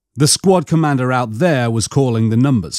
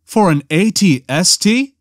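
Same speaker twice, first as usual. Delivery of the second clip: higher, disbelieving question; stunned; 5 semitones higher than usual. A narrator's reading. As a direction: ask it stunned, pitch climbing at the end. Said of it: "sounding stunned".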